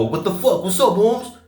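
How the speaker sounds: high-pitched mock yell, pitch jumping up; imitated yell. Kid accosting a man on the sidewalk, imitated high yell. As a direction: imitate someone yelling a challenge at a passer-by. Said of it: "in order to scream at me".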